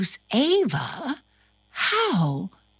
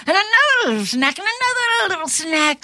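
faster, higher, rushing with glee; excited and quick; 10 semitones higher, much faster than usual. Shown as a demonstration. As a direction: speed up eagerly, much higher. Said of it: "repeated after plain take".

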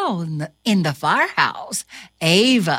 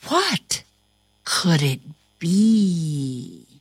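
usual speaking voice

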